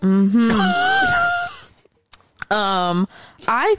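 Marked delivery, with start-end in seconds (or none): 0.6-1.5 high shrieking laugh. Real, not acted. Laughing out loud.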